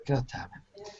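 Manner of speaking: whispered curse, barely audible; under her breath. Acted. Portia curses to herself, whispered under her breath. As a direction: swear softly under your breath. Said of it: "under her breath".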